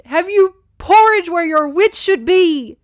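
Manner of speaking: slow, loud, high-pitched scolding; raging shout. A narrator's reading. Derek berates her after the rescue, raging shout. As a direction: shout the insult, hitting each word. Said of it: "turn from tenderness to rage".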